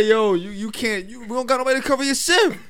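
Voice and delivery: high, loud mimicked complaint; retold loud protest. Coworkers begging him to cover the shift, loud mock protest.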